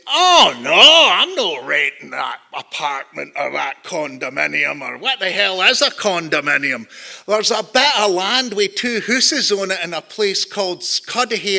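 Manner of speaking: imitated Glaswegian father refusing; Scottish dad. Dad objecting to renting an apartment, imitated Scottish father. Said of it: "My dad said".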